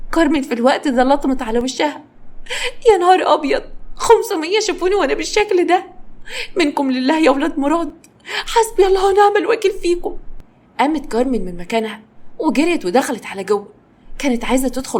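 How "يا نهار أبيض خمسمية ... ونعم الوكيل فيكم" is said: high, despairing cry of shame; cursing them in high distress; mock-tragic lament; lamenting wail. A narrator's reading. Carmen slaps her face, wailing.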